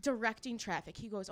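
ordinary delivery

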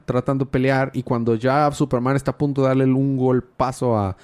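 ordinary talk

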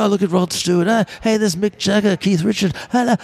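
a delighted mock exclaim at each famous face; Paul flipping through photos. Paul browsing photos, voiced.

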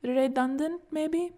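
"Redundant" tried out with a rising, questioning end; tentative repetition. A narrator's reading. Tries out the corrected English word.

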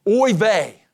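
Jesus' yell, lightly acted; mild mock yell. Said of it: "Jesus yells".

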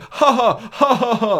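high, mock-sarcastic ha ha; sarcastic laugh. Moviegoer mimics rude viewers, sarcastic ha ha. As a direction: laugh at it like a bored critic.

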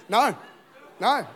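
ordinary delivery

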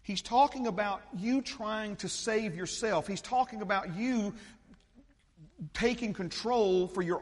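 loud, high-pitched preacher's delivery; full-force preaching. Real, not acted. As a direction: preach it hard, driving every phrase home.

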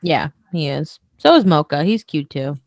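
talking while chewing food; mouth full. Real, not acted. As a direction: speak around a mouthful of food. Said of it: "Sorry, I'm eating food".

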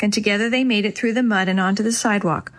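plain reading voice

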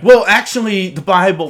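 high, loud Bible guy; churchy know-it-all. Acted. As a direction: correct them like a preachy churchgoer. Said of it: "like a guy at church being like".

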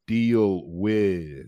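"deal with" as two drawn-out beats; slow teacherly "deal... with"; separated words. Shown as a demonstration. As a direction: model "deal with" slowly, one word at a time. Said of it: "two syllables here because each word".

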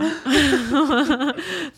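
laughing, almost crying with it; real laugh. Real, not acted. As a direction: laugh remembering the rakia shot. Laughs about rakia shot.

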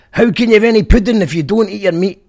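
put-on loud, high Scottish-accented shout. Fan quotes the [name] line, loud Scottish accent. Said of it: "in a Scottish accent".

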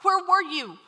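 grieving reproach. Retold or imitated. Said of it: "they're saying things to him like".

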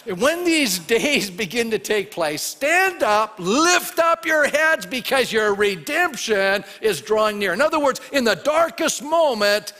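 loud, high, ringing proclamation; full-force preaching. Acted. Preacher quoting Jesus to believers, loud rousing proclamation. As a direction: proclaim it boldly, stretching key phrases.

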